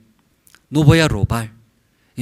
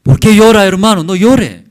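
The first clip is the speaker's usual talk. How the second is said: loud and higher, imitating a stern pastor; loud scolding; 6 semitones higher, 9 dB louder than usual. Pastor at a wake rebuking the weeping family, loud scolding. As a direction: call it out across the room like a rebuke. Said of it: "sí, fuerte hablo".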